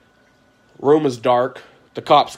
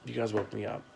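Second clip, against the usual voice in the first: much softer, drowsy mock-sleepy denial; playing it off; 10 dB quieter than usual. To the police at his door, softly playing it off. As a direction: fake being just woken up. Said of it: "I played it off".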